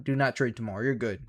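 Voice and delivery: ordinary talk, nothing marked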